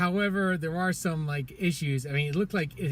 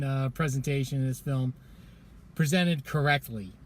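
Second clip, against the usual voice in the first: ordinary delivery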